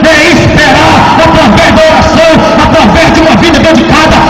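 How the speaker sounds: impassioned sermon climax; full-force preaching. Acted. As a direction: preach it with fire to the congregation.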